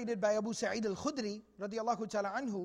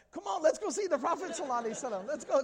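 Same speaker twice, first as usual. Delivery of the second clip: fast, louder, higher acted excitement; eager urging; 5 semitones higher, 5 dB louder, faster than usual. Companions rush the Bedouin to the Prophet, eagerly urging. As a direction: hurry someone along, brimming with eagerness.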